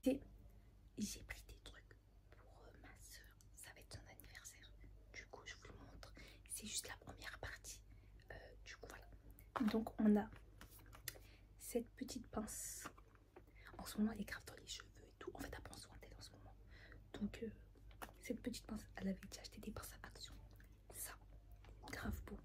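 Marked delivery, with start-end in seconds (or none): 1.0-16.5 soft whisper, keeping it hushed; hushed unboxing whisper. Real, not acted. Whispering her haul so nobody wakes up. 19.0-20.3 soft whisper, keeping it hushed; hushed unboxing whisper. Real, not acted. Whispering her haul so nobody wakes up.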